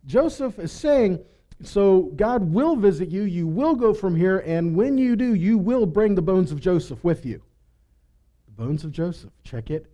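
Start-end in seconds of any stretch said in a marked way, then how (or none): none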